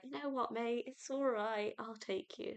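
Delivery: soft, cosy British voice for the whale; a friendly British whale, kindly offering a lift; British accent. Acted. Whale reassuring Percy in a British accent. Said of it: "I sounded really, really British".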